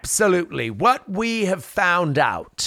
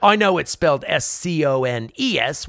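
ordinary talk

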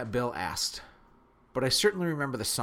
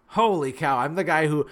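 "Holy cow" burst out much louder; loud exclamation; 3 semitones higher, 7 dB louder than usual. Real, not acted. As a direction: blurt "Holy cow" loudly in disbelief. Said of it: "I'm an ugly crier".

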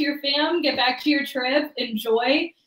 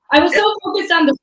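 ordinary talk

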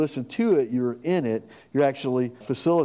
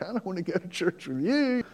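higher, dismissive mock reply; lightly voiced annoyed friend; mock refusal; 6 semitones higher, 3 dB quieter than usual. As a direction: brush off the invite with a hint of scorn. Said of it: "They're probably like".

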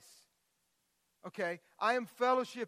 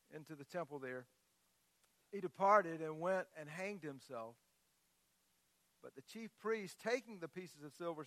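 quiet, measured Bible reading; hushed reading of scripture to the congregation; 3 semitones lower, 4 dB quieter than usual. Acted. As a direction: read the passage softly and gravely. Pastor reads Matthew 27 aloud.

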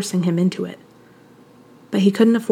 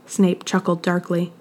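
usual speaking voice

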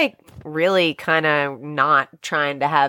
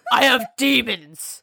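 demon-plagued caller; 6 semitones higher than usual. Pretend. Caller role at the help desk.

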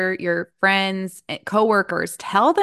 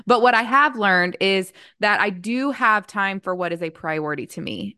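ordinary delivery

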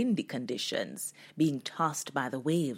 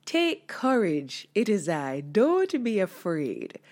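firm reassurance. Shown as a demonstration. Jesus calling to the frightened disciples, slow and firm. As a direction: say it slowly with firm command. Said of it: "I wonder how he really said it".

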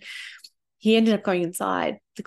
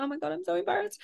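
high, softer mortified voice; embarrassed; 10 semitones higher, 6 dB quieter, faster than usual. Retold or imitated. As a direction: squirm with embarrassment. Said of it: "And I was like".

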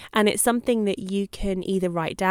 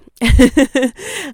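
loud laugh; 5 semitones higher, 11 dB louder than usual. Real, not acted. Laughing after her wry remark.

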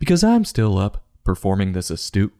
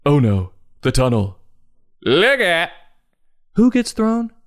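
put-on alarmed kid voice; 9 semitones higher, 3 dB louder than usual. Kids voiced as the tunnel hits.

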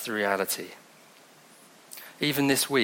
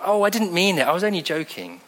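mock protesting; 7 semitones higher than usual. Preacher voicing a joker's excuse, mock protesting. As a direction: play at protesting your innocence.

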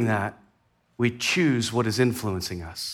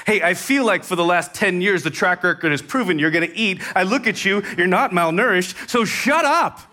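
raised, mock fed-up telling-off; exasperated parent; 5 semitones higher than usual. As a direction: play a parent losing patience. Fed-up parent answering the kids.

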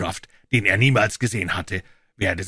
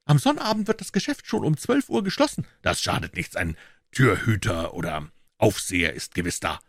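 Pycroft's higher voice; 9 semitones higher than usual. A narrator's reading. Pycroft answers Holmes.

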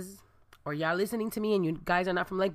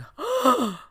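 high, loud mock gasp; big startled gasp, replayed for the story; shocked gasp; 9 semitones higher, 5 dB louder than usual. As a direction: act out a sharp horrified intake. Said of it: "So I was just like".

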